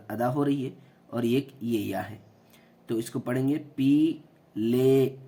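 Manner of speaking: ordinary delivery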